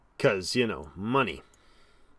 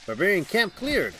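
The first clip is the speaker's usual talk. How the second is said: excited; 8 semitones higher, 4 dB louder than usual. Real, not acted. Reading game victory popup.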